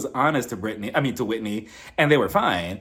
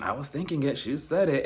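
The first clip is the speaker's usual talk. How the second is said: usual speaking voice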